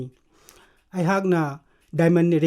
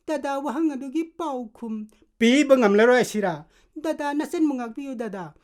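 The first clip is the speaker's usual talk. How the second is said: higher-pitched sister begging her brother; acted plea in a raised pitch; Amina pleading; 9 semitones higher than usual. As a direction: beg an elder brother for protection. Plays Amina pleading with elder brother.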